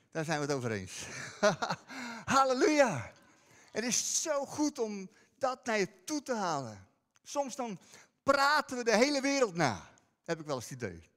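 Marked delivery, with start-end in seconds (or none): none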